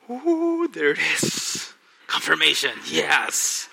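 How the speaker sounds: high, loud, thrilled exclamation; a delighted squeal of recognition; excited ooh. Retold or imitated. Excited at confirmation in prayer.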